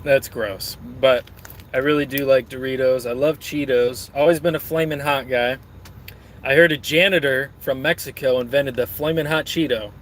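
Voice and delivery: ordinary talk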